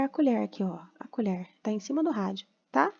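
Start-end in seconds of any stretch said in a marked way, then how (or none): none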